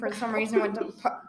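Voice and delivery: ordinary talk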